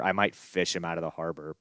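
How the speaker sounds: ordinary talk, nothing marked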